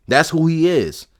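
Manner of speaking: ordinary talk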